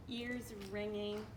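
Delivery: plain reading voice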